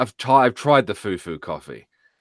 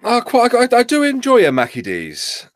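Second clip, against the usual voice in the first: high, hammed-up posh English voice; mock posh Brit; 14 semitones higher, 5 dB louder than usual. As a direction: play the plummy toff, pitched way up. Mock posh British aside.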